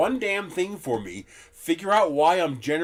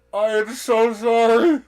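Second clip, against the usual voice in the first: high, slow, laughing apology to the biker; mock apology; 9 semitones higher, 10 dB louder than usual. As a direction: apologise slowly, half laughing. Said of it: "I looked at him and just went".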